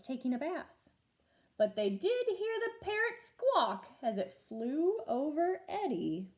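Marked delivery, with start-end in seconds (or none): none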